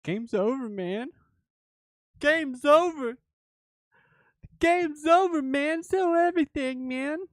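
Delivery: high-pitched, loud hammed-up freak-out; mock panic. Mock panic that the market is lost.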